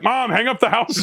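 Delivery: high, slow whine at mom; teen begging his mom to get off the line, high and slow; mock whiny teen. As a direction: whine up at mom from the other room. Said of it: "you're like".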